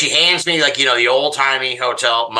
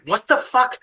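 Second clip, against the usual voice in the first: dumbfounded; 13 semitones higher than usual. Retold or imitated. Dumbfounded retelling.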